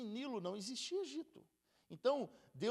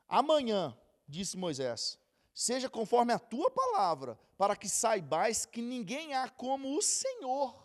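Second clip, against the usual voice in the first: louder, slowed Bible reading; scripture reading; 3 semitones higher, 8 dB louder, slower than usual. Acted. Preacher reading Moses' reply aloud, solemn. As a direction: read the verse out as a proclamation.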